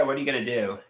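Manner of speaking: usual speaking voice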